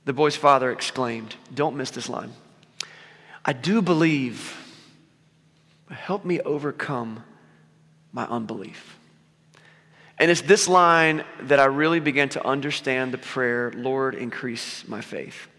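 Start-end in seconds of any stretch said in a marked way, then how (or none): 3.4-9.0 quiet plea. Retold or imitated. Father's desperate plea, retold. 13.8-15.5 soft prayer. Retold or imitated. Quoting a prayer softly.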